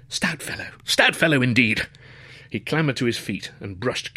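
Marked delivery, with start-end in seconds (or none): 0.1-0.7 breathy whispered thanks; student hushing his thanks to the porter. Acted. Whispered grateful aside. 0.8-1.9 full-voiced, warm toff thanks; student thanking the porter aloud; hearty thanks. Voiced grateful repeat.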